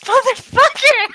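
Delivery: high, loud outburst; shouting curse. Real, not acted. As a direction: yell it in pain. Cat bites her.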